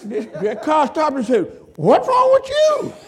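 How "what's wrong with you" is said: very high squeak of a stranded driver; mock driver. Preacher voices a stranded driver yelling at his car, very high.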